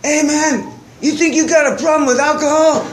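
a hint of a teasing stranger; the guy in the lowrider needling the drunk narrator, lightly voiced; imitating the guy. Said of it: "one guy's like".

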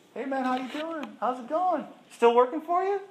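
high, bright mock greeting; friendly ribbing. Daryl dropping by work, teasing him in a high voice. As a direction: tease him cheerfully. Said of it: "Him and Luke come by".

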